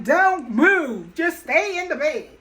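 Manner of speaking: loud, firm doctor's orders; doctor scolding a patient, loud and firm; stern doctor. Retold or imitated. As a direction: bark the orders like a strict doctor. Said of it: "The doctor was like".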